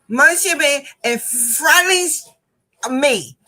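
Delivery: high, loud, slow garbled speech; laboured, loud retold jumble; frustrated student imitation. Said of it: "this is what she's saying".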